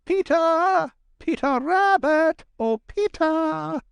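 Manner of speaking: high, drawn-out call for a friend. A narrator's reading. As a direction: call across the briar patch, high-pitched. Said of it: "he called".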